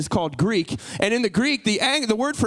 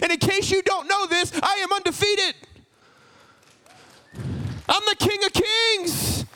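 high, ringing proclamation as God; God declaring; 6 semitones higher than usual. Acted. Preacher voices God answering a doubter, high and emphatic. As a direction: proclaim each title high and emphatic, drawing out the last. Voicing God's emphatic reply.

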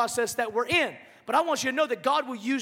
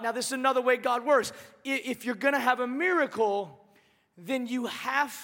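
usual speaking voice

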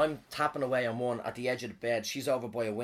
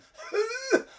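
high wheezy hoot of a cough; imitated little cough; 5 dB louder than usual. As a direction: act out a small sick wheeze. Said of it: "I had a bit of a cough".

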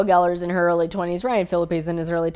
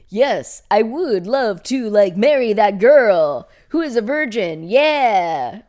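loud, jazzed-up dummy enthusiasm; Keanu Reeves; 4 semitones higher, 8 dB louder, slower than usual. Acted. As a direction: gush it big and drawn out, like an eager dimwit. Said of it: "really jazzed up about opera".

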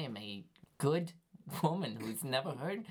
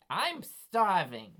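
drawn-out declaration; 6 semitones higher, 7 dB louder, much slower than usual. Retold or imitated. Lady declaring her hunger.

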